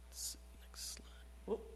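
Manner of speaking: a quick, hushed whisper to the tech; unvoiced whisper aside. Real, not acted. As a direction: breathe it off-mic so only the helper hears. Aside to the slide operator.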